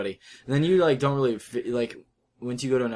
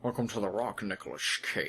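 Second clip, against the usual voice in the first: put-on Sean Connery Scottish accent; 2 semitones lower, 7 dB quieter than usual. Host quotes The Rock in a soft Scottish Connery accent. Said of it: "Sean Connery".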